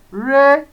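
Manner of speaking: "Re" on a steady middle pitch. Shown as a demonstration. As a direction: say "Re" level, no push. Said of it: "no difference".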